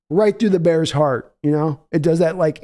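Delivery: ordinary talk, nothing marked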